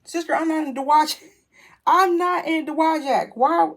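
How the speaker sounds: high, loud exclamation breaking into a laugh; loud disbelief. Retold or imitated. Car owner sees wrong town on app, loudly incredulous. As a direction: protest at full pitch, half laughing.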